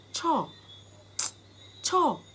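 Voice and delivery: short falling "cho" of annoyance; dismissive Jamaican "cho". Shown as a demonstration. Teacher showing the short teeth-hiss reaction, dismissively. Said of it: "you have the short version".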